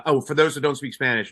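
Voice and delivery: ordinary talk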